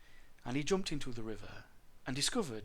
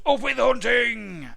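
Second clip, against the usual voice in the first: high, loud, drawn-out shout; full-voiced hunting cry; 10 semitones higher, 12 dB louder, slower than usual. A narrator's reading. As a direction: bellow it slowly as a war chant. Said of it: "they shouted".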